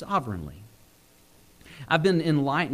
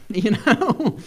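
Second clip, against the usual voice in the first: ordinary talk, nothing marked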